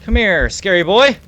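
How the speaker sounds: high, loud challenge to the monster; nervous taunting shout. Real, not acted. Frightened player yelling at the mimic to come closer, high and loud. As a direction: yell to draw the creature out.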